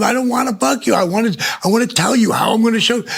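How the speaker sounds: high, hurried insistence; retold insistent pleading. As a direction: pitch it way up and rush to explain. His excited pleading in retold argument.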